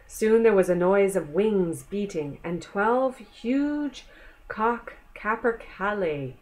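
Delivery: ordinary delivery, nothing marked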